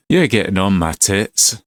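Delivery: put-on irritated Glaswegian; Scottish accent. Master fed up with questions, Scottish accent. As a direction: act a fed-up Scottish trainer. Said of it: "Sorry about the Scottish accent".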